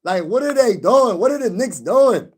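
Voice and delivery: high, swooping cry of disbelief; exasperated. Real, not acted. Venting to livestream viewers about the Knicks' loss, exasperated. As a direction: wail it in exasperation, pitch soaring up and down.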